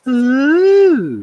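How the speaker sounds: a high, loud mocking "Ooh". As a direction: tease him with a high, loud coo. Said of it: "Proper mocking me".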